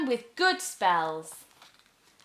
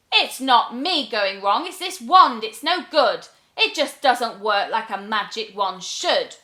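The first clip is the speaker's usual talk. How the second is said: louder, huffy blaming; indignant witch; 7 dB louder than usual. Acted. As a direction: blame the wand hotly. Witch blames wand.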